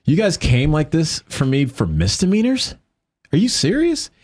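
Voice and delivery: can't believe it's for misdemeanors; incredulous. Retold or imitated. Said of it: "I'm like".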